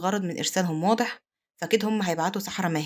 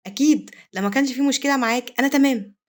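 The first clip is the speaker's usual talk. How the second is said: put-on higher, louder young woman's voice; a lightly voiced young wife, keen to agree; Arsel's eager reply; 6 semitones higher, 6 dB louder than usual. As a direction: answer brightly and eagerly. Arsel recalls her reply.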